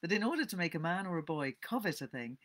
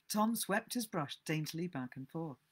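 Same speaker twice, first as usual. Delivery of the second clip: ordinary delivery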